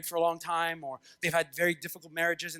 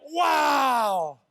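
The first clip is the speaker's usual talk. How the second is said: amazed; 5 semitones higher, 5 dB louder than usual. Acted. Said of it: "you read this and you're like".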